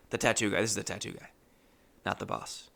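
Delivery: ordinary talk, nothing marked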